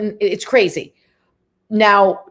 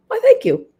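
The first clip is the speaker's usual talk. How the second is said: high-pitched accented impression of a flattered actor; Anthony Hopkins; 13 semitones higher than usual. Film star answering a compliment, in a put-on accent. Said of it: "you just went into one there".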